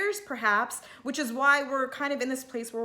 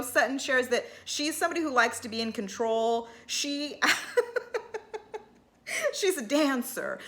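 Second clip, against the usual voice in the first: ordinary talk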